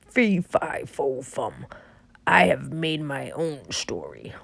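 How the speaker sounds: low, slow giant voice; booming giant. Acted. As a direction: rumble it out like a giant. Giant chants his rhyme.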